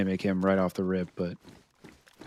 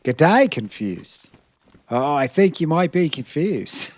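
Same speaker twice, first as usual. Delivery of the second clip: clumsy Australian impression; bad Aussie accent; 4 semitones higher than usual. Streamer imitates Jimmy greeting Confused, bad Aussie accent. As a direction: ham up a poor Australian accent. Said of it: "I'm not good with the Au-, the Aussie accent".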